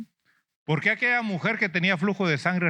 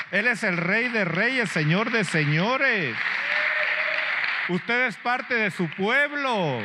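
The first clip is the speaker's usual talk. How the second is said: slow proclaiming; 6 semitones higher, slower than usual. Real, not acted. Preacher proclaims Christ's reign.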